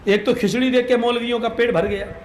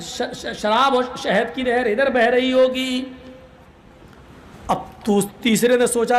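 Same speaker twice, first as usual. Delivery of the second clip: ordinary talk, nothing marked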